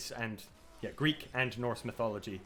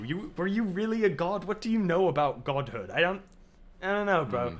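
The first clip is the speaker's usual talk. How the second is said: a hint of higher, building mockery; taunting Odin; 5 semitones higher, 4 dB louder than usual. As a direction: pile on the insults, mocking. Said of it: "and he's just like".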